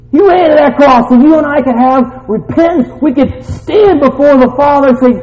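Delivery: high, loud, full-force proclamation; impassioned preaching. Real, not acted. Preacher at climax.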